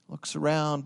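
ordinary delivery

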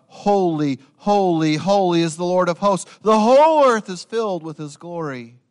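loud, high ringing proclamation; heavenly chorus calling out holy; proclaiming seraphim. Acted. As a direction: cry it out like angels before the throne. Seraphim call out in vision.

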